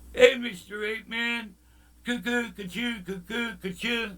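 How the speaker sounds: playful singsong cuckoo call, pitched high; silly mock call. Sudden silly quote with cuckoo noises.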